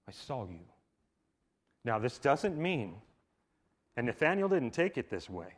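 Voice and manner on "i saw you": echoed low and soft; hushed. Shown as a demonstration. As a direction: let it linger, quiet. Repeated softly.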